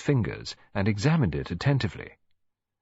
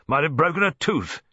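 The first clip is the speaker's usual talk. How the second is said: louder, higher grumble; grumbling; 8 semitones higher, 4 dB louder than usual. A narrator's reading. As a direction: complain as an old colonel. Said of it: "he grumbled".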